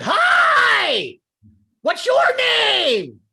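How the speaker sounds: high, very loud, drawn-out shout; creepily overeager, stretched-out yell; screamed greeting. Shown as a demonstration. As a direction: yell an over-the-top greeting at a stranger. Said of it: "I yelled and I screamed".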